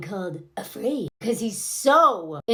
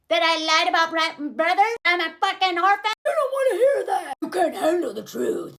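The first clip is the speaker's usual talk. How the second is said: higher, strained mock rant; imitated yelling; 5 semitones higher than usual. Man confessing his past to her, mock yelling. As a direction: play his tirade in a put-on cracking voice. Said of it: "he's yelling all of this".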